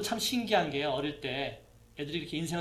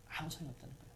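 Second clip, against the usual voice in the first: much softer repeat of 아무 소용이 없다는 거예요; soft echo; 14 dB quieter, much faster than usual. Real, not acted. Preacher repeating his point to the congregation, much softer echo. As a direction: let 아무 소용이 없다는 거예요 fall away almost under the breath.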